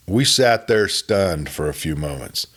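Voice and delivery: ordinary delivery, nothing marked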